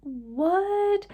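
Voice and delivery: high, breathless what; can't believe the cashier's kindness; stunned. Retold or imitated. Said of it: "And I was like".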